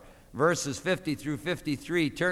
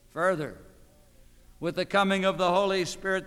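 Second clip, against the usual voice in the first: normal talking voice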